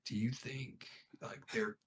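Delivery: very soft, near-whispered aside; hushed realization. Retold or imitated. Brother confides in sister as cops search, hushed realization. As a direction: confide it under your breath, afraid it's your fault.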